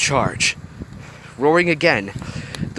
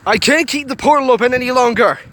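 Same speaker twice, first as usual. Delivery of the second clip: high, loud warning across the room; shouting; 12 semitones higher, 4 dB louder than usual. A narrator's reading. Craig calling from the control room, urgent shout. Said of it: "Craig shouted from the control room".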